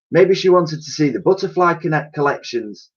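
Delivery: musing like the museum lady; lady suggesting the butterfly collection, high-voiced; lady's voice. A narrator's reading. Lady character voice.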